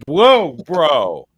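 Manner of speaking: high, loud "whoa" of shock; startled reaction. Retold or imitated. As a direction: recoil aloud from an awful sound. Retold shocked reaction to awful audio.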